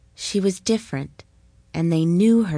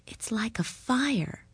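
hushed breathy whisper, slow; soft slow whisper of longing; 4 semitones higher, 6 dB quieter, slower than usual. Acted. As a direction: breathe it like a cherished memory. Margot remembers the sun.

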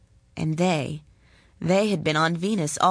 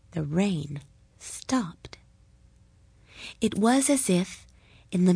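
whisper. A narrator's reading. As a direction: let the silence fall on it. Climax of the story.

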